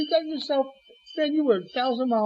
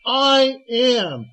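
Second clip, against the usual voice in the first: booming proclamation; 4 semitones higher, 7 dB louder than usual. Acted. Preacher's climax quoting Jesus.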